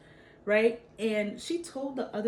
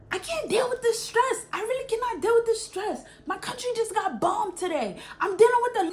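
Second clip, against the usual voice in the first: high, loud, wailing fake meltdown; mock crying outburst; 12 semitones higher, 7 dB louder than usual. Kid wailing at her teacher in a mock meltdown. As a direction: throw a hammed-up tantrum at the teacher. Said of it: "like, crying, screaming".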